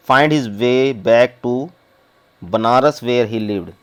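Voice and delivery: reads the English very slowly, word by word; slow story reading. Acted. Teacher reading the story text to the class, slow and deliberate. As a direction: spell the story out slowly for students.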